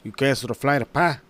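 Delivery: imitated thick Mexican accent, "Pac" said as "pa"; mock Mexican-accented question. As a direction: put on a Mexican accent and drop the final k of "pa". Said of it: "his Mexican accent is just so damn thick".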